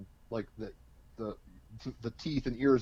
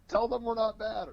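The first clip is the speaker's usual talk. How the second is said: much higher-pitched mock plea; diplomatic pleading; 11 semitones higher than usual. Libra poking the German guy, high-voiced diplomatic plea. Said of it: "trying to diplomatically deal with the situation".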